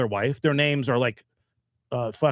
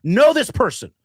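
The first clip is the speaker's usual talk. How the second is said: exasperated protest; 11 semitones higher, 6 dB louder than usual. Retold or imitated. Retells protest in bar quarrel.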